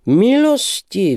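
high, slow, loud cry of Milosti; hammed-up gallant exclamation; cried out. The young man cries out to the lady, high and loud. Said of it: "vzkřikl mladík".

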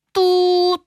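high, long, steady toot; imitated car horn. A narrator's reading. Cars honk around Julinka at crossroads, hooting.

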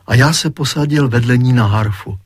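plain reading voice

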